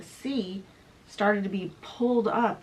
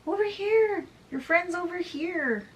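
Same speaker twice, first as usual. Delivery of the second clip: much higher siren call, thin and far-off; high-pitched siren voice; 11 semitones higher than usual. Acted. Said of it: "he heard a high-pitched voice".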